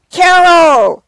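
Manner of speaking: calling out the winner's name, high and loud; proud, ringing name call; excited announcement. Real, not acted. Announcing the big award winner.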